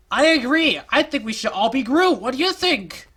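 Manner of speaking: high, loud eager cheer; overexcited. Acted. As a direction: burst in with manic enthusiasm. Overeager character.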